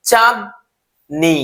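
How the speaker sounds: very slow, syllable by syllable; teacher stretching a name slowly into syllables; split into syllables. Shown as a demonstration. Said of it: "যখন আমি এভাবে বললাম কিনা".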